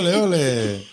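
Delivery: high festive ole with a laugh; cheering. Real, not acted. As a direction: cheer like at a flamenco show. Cheering the caller from Sevilla.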